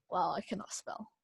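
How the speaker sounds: whispered under the breath; hushed self-mocking whisper; whispered aside. Real, not acted. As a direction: mutter it to yourself in a whisper. Muttering to self while typing.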